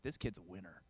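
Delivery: very low, soft aside; private inner thought. Retold or imitated. Comedian privately admires the boy, low hushed aside. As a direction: drop it down low as a secret verdict. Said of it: "In my head, I'm like".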